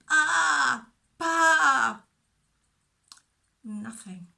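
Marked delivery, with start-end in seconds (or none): 0.1-2.0 a high, loud, bleating baa; loud sheep bleat. Acted. Lost sheep stuck in a bush, bleating loudly for help. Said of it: "So he tried".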